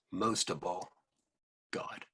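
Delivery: usual speaking voice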